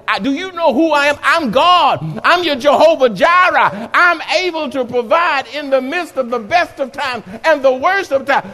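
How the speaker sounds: high, loud, fast proclamation as God; God speaking. Acted. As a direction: announce it as the Almighty.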